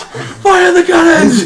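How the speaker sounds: battle cry. Pretend. Acted battle, cannon order.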